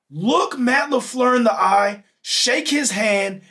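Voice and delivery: each word spelled out slowly; slow and deliberate. Real, not acted. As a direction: lay it out slowly, like a lesson. Lecturing the coach on handshakes.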